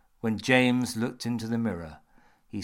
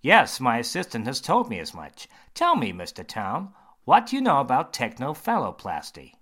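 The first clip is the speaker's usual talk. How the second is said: louder, brighter surgeon voice; a confident doctor holding forth; 4 semitones higher, 8 dB louder than usual. Acted. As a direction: surgeon quizzing James, bright and loud.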